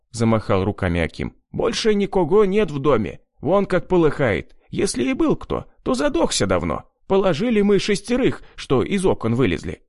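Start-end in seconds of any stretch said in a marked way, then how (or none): 1.5-9.8 a hint of an excited peasant, higher; lightly voiced Akim. A narrator's reading. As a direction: report it breathlessly. Akim reports to the narrator. (слегка озвученный взволнованный Аким)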